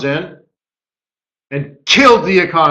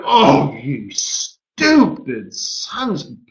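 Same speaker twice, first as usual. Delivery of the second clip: slow, softer mock curse; imitated exasperated swearing, trailing off; cursing under strain; 5 semitones lower, 4 dB quieter, much slower than usual. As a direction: drag out the swear in imitated disgust. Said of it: "Mark Carney was cursing".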